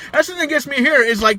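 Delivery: ordinary talk, nothing marked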